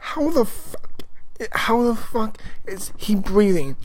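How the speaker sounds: high, slow, stunned outburst; shocked disbelief. Retold or imitated. Fan reacting to Maul surviving in space, high and stunned. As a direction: squeal it out in disbelief, drawn out. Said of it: "I'm like".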